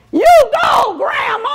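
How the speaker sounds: high, loud You go, Grandma; yelled cheer. Retold or imitated. Student yelling at the speaker from the audience, loud and high. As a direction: holler it from the crowd at her. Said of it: "So she yells".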